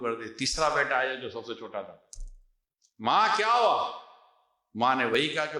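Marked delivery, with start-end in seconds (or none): 3.0-4.0 higher, louder, slow worried cry of ma; a son bursting in, alarmed; alarmed call. Retold or imitated. Eldest son finding mother crying, alarmed high call.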